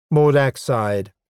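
ordinary delivery

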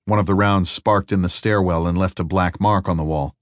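plain reading voice

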